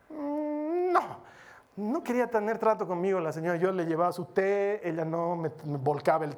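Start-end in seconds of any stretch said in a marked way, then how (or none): 0.1-1.3 a high, clipped "mm, no" in her voice; mimicking the lady's refusal. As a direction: brush him off with a short hum. Imita otra negativa de la señora. (la señora vuelve a negarse, voz aguda y cortante)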